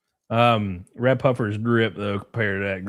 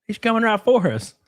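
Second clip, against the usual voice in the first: high, panicked cartoon cry; South Park hunter voice; 11 semitones higher than usual. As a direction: yell it like a scared hunter. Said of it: "the South Park defense".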